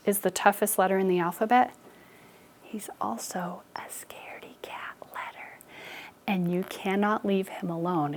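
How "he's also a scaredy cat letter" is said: hushed, secretive whisper. Acted. Confiding U is scared.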